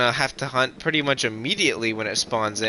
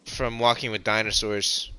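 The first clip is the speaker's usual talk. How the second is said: usual speaking voice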